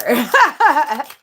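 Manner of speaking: high, loud burst of laughter; real laugh after a cutting remark. Real, not acted. Laughs after her own cutting question.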